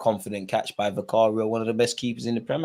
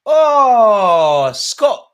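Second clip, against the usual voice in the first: high, drawn-out wail of frustration; loud lament; 10 semitones higher, 11 dB louder than usual. Real, not acted. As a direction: wail it slowly at the player's miss. Laments the missed chance.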